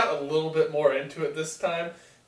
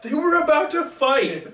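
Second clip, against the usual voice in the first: louder, high-pitched mock protest; heated retort; 11 semitones higher, 5 dB louder, slower than usual. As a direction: stretch it out, high and insistent.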